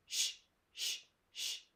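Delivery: quiet unvoiced hushing sh; whispered sh, sh; soft sh sound. Shown as a demonstration. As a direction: let out a soft shh. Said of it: "C H has a soft C H, like".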